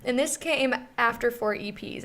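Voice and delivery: ordinary talk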